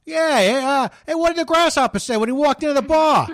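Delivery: high-pitched joking radio host; car radio host. Acted. Car show host telling a caller a joke, high hammy voice. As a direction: play the jokey call-in car show host. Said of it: "I'll be click or clack".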